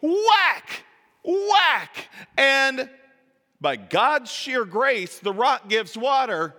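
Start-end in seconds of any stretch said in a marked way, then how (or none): 0.0-2.1 loud, high, slow whack whack imitating the staff blows; whack sounds. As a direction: smack each whack like a bat hit. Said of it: "uses it like a baseball bat".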